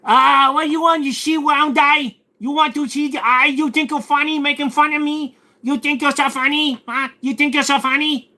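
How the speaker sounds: high, loud mock Korean accent; indignant rant in an exaggerated Korean accent. Streamer mocks chat for making fun of him, high Korean accent. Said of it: "that was a Korean accent".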